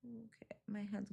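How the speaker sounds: breathy whispered okay to herself; a private whispered okay. Real, not acted. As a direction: whisper it under your breath. Mutters to herself while working.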